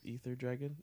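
ordinary talk, nothing marked

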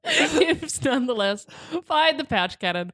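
reading through real giggles; laughing. Real, not acted. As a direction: try to keep reading while cracking up. Cracking up at the absurd article.

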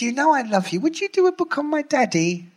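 Sharon's sweet coaxing. Retold or imitated. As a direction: sweet-talk him into the favour. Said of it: "And she said".

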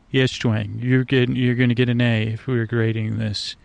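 ordinary delivery

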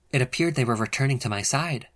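ordinary delivery, nothing marked

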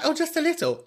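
high, squeaky mimicry of the dainty; put-on high little voice; mocking timid people. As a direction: imitate a fussy eater in a high voice. Mocks people wanting little salt.

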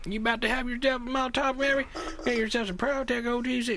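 high put-on Southern comedian voice; Larry the Cable Guy impression. Joking about a heartburn ad, folksy pitchman voice. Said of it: "That's the Larry the Cable Guy doing it".

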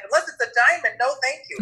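high, sing-out refusal; dismissive retort. Retold or imitated. Narrator answering his partner's call, high dismissive retort.

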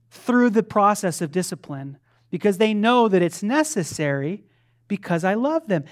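ordinary delivery

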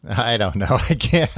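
ordinary talk

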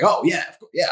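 mock over-keen kid saying yes; eager yes. Eager young self agreeing.

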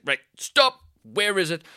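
urgent halt. Retold or imitated. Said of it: "it's like".

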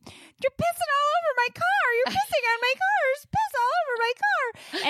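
very high, frantic shriek; retold screaming. Mom shrieking at son peeing in car, frantic high scream. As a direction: shriek in horror at the mess. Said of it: "I'm sitting here just screaming".

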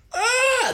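very high, sudden mock sneeze shriek; imitated explosive sneeze. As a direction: blast it out like a missile going off. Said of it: "it'd be like this".

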